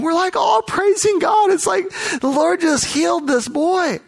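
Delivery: exultant retelling of the moment; joyful praise. Retold or imitated. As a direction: pitch leaps high, rising rapture. Crowd rejoicing over healing.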